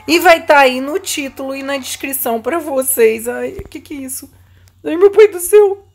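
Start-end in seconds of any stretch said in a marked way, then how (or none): 4.8-5.8 frightened cry. Real, not acted. Scared by Granny in game.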